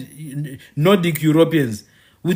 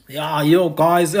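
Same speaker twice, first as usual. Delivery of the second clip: mock Gabrielle Union; slower than usual. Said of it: "Speak like this, like Gabrielle Union".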